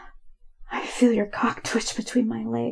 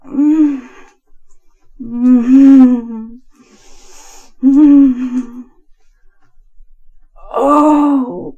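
loud drawn-out moans building to an "oh"; sustained, swelling moans of pleasure; climactic moaning; 12 dB louder, much slower than usual. Acted. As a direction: moan louder and longer with each breath. Acted climax of intimate narration.

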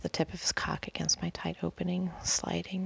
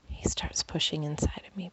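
plain reading voice

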